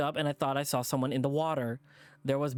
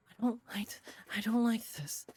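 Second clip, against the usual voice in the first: breathless, unvoiced whisper, higher pitch; frightened whisper; 7 semitones higher, 3 dB quieter than usual. Narrator whispering dread to herself in a frightened whisper. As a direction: whisper it like a secret you dread.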